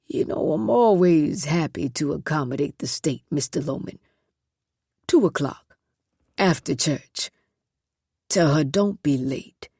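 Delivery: lightly voiced sly southern boss. Haddock's voice in dialogue.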